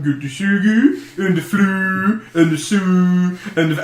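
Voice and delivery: slow singsong mock-Swedish gibberish; Swedish Chef. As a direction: drag out each vowel in mock Swedish. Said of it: "we do the accent all the time".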